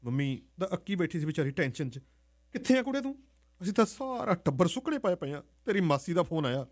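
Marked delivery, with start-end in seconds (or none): 2.5-6.6 high and loud, demanding; frantic mother wanting answers; mother on the phone demanding where her daughter is; anxious mother. Acted.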